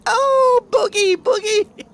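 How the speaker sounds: very high, loud, slow wail; mock screaming niece. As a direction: scream like a frantic little girl. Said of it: "she's screaming".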